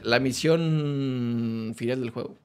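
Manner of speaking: low, very slow trailer voice; dramatic title read. Acted. Gamer announcing the final mission, slow trailer voice.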